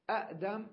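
أأدم with short a, glottal catch, then dam; two separate hamzas. Shown as a demonstration. Teacher pointing out the first hamza, split with catch. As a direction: break أأدم after the first a. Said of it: "حركة الهمزة الأولى فتحة".

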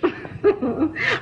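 soft little laugh after a thank-you; brief, pleased chuckle; light chuckle. Acted. Mother thanking her son, light chuckle.

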